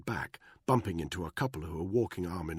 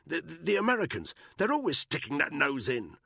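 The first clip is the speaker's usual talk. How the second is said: high, choked stammer; strangled voice; 10 semitones higher, 4 dB louder than usual. A narrator's reading. Buckingham choked by Danny, strangled and high. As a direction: squeeze it out as if half-throttled. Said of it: "in a strangled voice".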